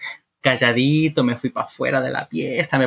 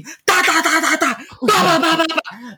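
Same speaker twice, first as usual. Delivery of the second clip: high, hammering mimicked racket; imitated loud water; 14 semitones higher, slower than usual. Wife drowning out her husband, high imitated racket. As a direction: rattle off syllables like a blasting tap. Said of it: "lo puse la hueá bien fuerte".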